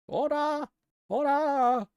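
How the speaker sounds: high, loud call for order; judge calling order. Acted. Judge silencing the courtroom, high shouted order.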